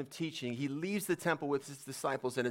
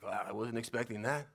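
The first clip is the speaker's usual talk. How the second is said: lower, mildly let-down murmur; a little deflated; 4 semitones lower than usual. Retold or imitated. Disciple taken aback by the answer, a little deflated. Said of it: "might have been a little deflated".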